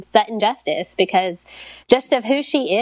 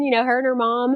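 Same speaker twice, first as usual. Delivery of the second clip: usual speaking voice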